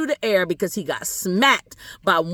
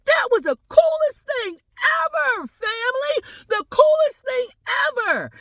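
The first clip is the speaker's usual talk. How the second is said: very high, stretched-out excited shout; thrilled kid. Acted. Boy jumps up thrilled to tell his family. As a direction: burst out ecstatic, way up high. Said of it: "jumps up and is like".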